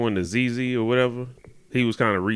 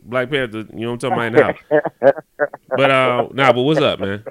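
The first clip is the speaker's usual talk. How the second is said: talking through a face mask; muffled, mask over mouth; 2 semitones higher, 7 dB louder than usual. Real, not acted. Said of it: "if I sound a little, um, muffled, that's why I got my mask on".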